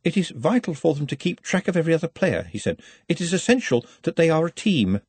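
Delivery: earnest, careful justification; a touch of embarrassed nerves; nervously. A narrator's reading. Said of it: "Nutt coughed nervously".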